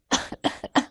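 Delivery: acted coughing and spluttering; sneezing fit. Father chokes on his first spicy bite, spluttering. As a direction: choke and splutter without words. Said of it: "عطس عطسة قوية قوي".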